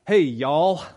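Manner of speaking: a loud Southern drawl on "Hey, y'all"; Southern accent. Retold or imitated. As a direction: call out "Hey, y'all" the Texas way. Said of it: "if you hear the word".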